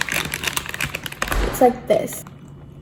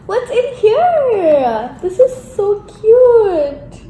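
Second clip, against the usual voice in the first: high, drawn-out cooing squeal; delighted squeal; 11 semitones higher, much slower than usual. Real, not acted. Vlogger finding a keepsake in mom's package, delighted squeal. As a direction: coo over an adorable surprise, stretching the words.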